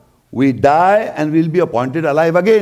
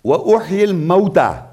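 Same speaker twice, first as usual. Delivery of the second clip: Quranic recitation; 3 semitones higher, much faster than usual. Acted. Quick Quran quote.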